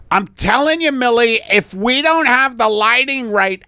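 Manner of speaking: high, insistent boss voice; insistent photographer. Acted. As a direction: press the point on a balky model. Photographer argues in the skit.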